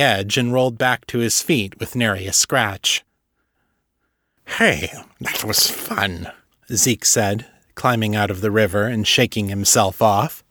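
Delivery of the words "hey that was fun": lightly voiced creature, slow and low; a hint of a slow, easygoing creature; Zeke's voice. A narrator's reading. Zeke climbing out of the river, lightly voiced and slow.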